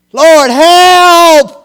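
loud, high, drawn-out shout; exclaimed cry. Shown as a demonstration. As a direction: cry out for help with an exclamation point. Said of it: "With an exclamation point after it".

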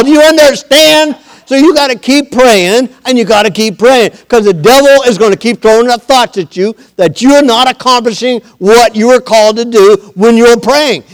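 high, loud pulpit exhortation; full-force preaching. Real, not acted.

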